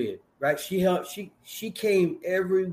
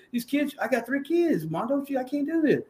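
high, pleading, near tears; pleading wife; 10 semitones higher, 3 dB quieter than usual. Retold or imitated. The wife tells him she can't wait out another bid, high and pleading. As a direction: beg him as the worn-out mother.

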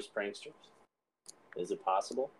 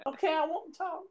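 high, softer squeaky plea; mock victim giving in; 5 dB quieter than usual. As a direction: give in with a squeaky pretend whimper. Victim gives in to captors.

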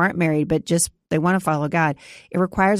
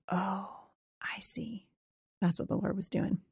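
hushed realization; 3 semitones higher, 13 dB quieter than usual. Retold or imitated. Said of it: "you can start to go".

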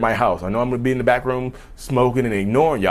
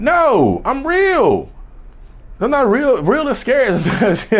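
excited; 14 semitones higher, 3 dB louder, slower than usual. Pretend. Exaggerated self-aware narcissist.

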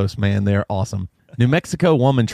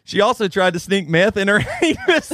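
higher, breaking into laughter; about to cry laughing; 6 semitones higher than usual. Real, not acted. Reading the story's punchline to friends, laughing to tears. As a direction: fight the giggles, then lose it. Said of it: "I'm starting to cry here".

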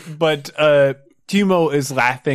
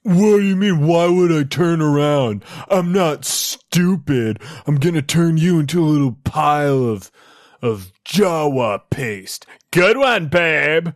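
dopey cartoon bellow; 4 semitones higher than usual. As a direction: bellow it like a thick thug. Bodyguard voice.

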